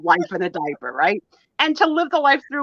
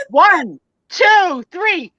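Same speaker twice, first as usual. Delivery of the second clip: loud countdown, each number climbing higher; 8 semitones higher, 7 dB louder, much slower than usual. Real, not acted. Counting down loud to toss the prop.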